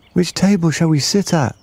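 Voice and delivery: put-on high eager child asking; bright young kid voice. A narrator's reading. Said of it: "said one".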